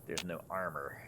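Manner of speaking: ordinary talk, nothing marked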